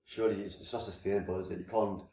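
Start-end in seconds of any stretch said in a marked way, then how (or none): none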